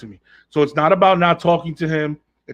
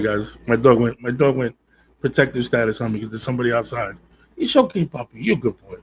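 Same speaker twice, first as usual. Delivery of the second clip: usual speaking voice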